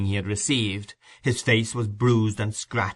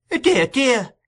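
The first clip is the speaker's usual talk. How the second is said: much higher, pious dear dear; pious exclamation; 5 dB louder than usual. A narrator's reading. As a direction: sigh it out piously. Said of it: "ejaculated Missus Sowerberry, piously".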